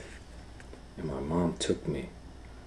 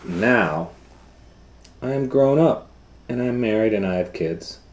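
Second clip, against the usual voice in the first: loud, high, quick storybook voice; reading to children; 8 semitones higher, 13 dB louder, faster than usual. Acted. Teacher reads the book's ending to the class, loud and high. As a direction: read on eagerly for the kids. Reads picture book aloud.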